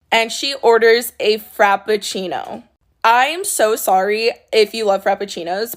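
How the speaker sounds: usual speaking voice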